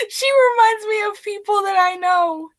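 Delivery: high, loud, laughing through the words; can't get it out for giggling. Real, not acted. Telling viewers why she hates Tinkerbell, laughing. Laughing while explaining a hated character.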